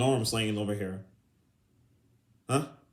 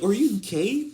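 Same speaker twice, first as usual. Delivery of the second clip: high, rising incredulous question; mocking disbelief; 8 semitones higher, slower than usual. Imitating a man questioning another, high and incredulous. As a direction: ask it high, as a jokey accusation. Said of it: "just how the tone you say it".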